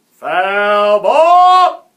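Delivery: very loud, high, drawn-out call; umpire's shout. A narrator's reading. The umpire calls a foul, shouting. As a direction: bellow the ruling across the field. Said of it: "shouted the umpire".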